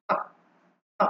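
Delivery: আ from deep in the throat; throat vowel. Shown as a demonstration. Teacher showing students the আ from the throat. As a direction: open আ voiced from the throat. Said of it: "গলা থেকে আমরা আ এর মতো করে উচ্চারণ করব".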